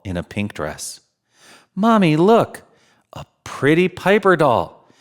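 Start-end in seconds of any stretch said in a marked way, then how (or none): 1.7-4.9 lightly voiced girl tugging at her mother; excited little girl. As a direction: play a keen little girl pointing at a doll.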